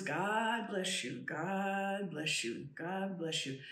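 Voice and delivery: slow, hushed God bless you; soft slow blessing from another being; soft repeated blessing. Retold or imitated. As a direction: murmur it slowly like a benediction. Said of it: "are coming out".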